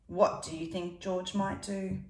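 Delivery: plain reading voice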